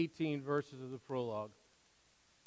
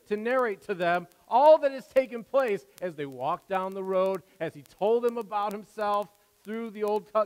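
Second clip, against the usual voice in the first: loud, high preaching at full force; fervent pulpit delivery, much louder and higher; 7 semitones higher, 12 dB louder than usual. Acted. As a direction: preach it out to the congregation.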